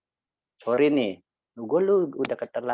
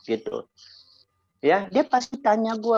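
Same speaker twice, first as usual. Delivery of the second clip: ordinary delivery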